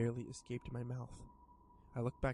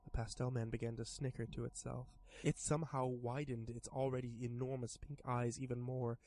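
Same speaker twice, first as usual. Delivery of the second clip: plain reading voice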